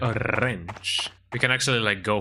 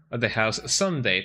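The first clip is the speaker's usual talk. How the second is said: usual speaking voice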